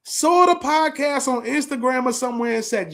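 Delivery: ordinary talk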